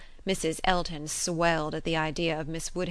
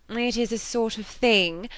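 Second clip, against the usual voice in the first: slow, raised, emphatic "It is a sort of thing"; weight "sort of thing" with higher, louder, drawn-out indignation; 6 semitones higher, 6 dB louder than usual. A narrator's reading. Mrs. Elton objecting, emphatic "sort of thing". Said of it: "cried Missus Elton emphatically".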